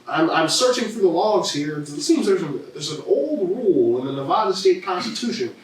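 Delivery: Air Bud guy voice. Retold or imitated. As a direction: read out an absurd rule like a goofy sidekick. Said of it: "the guy from Air Bud".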